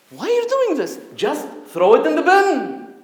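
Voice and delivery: high, loud exasperated protest; exasperated outburst. Retold or imitated. Son to father tearing paper, a high loud exasperated protest. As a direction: throw up your hands, high and loud. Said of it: "I'm like".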